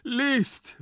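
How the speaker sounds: high, loud imperative cry; theatrical high-pitched shout; desperate call. Acted. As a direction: cry it out urgently, twice. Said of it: "rief Gumpellino".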